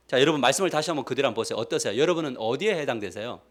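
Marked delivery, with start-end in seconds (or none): none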